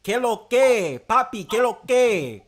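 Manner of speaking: high, loud, slow put-on Dominican greeting; mock Dominican. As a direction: toss out a hammed-up Dominican hello. Hamming up Dominican slang.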